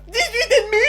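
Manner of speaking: laughing. Acted. Son reads grades, laughs.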